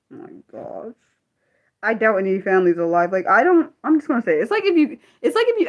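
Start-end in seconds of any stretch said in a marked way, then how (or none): 0.1-0.9 breathy under-the-breath oh my gosh; whispered aside. Real, not acted. Vlogger aside to herself, whispered exasperation.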